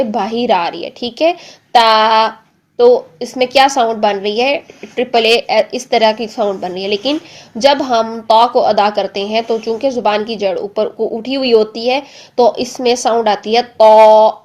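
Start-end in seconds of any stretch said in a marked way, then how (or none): none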